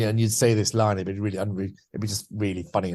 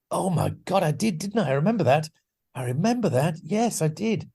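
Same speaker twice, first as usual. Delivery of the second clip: higher-pitched retold burst of remembering; sudden recollection; 6 semitones higher than usual. Steve suddenly remembers his old sketch character, retold surprised recognition. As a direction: act out a memory suddenly clicking. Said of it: "a real click in his mind".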